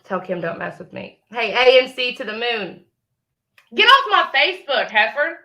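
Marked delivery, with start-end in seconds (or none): 3.7-5.4 louder, higher scornful shout; yelling at the rival to leave. Real, not acted. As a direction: shout her off your page.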